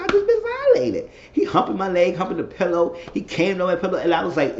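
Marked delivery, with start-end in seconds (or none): 0.0-1.1 mock outrage.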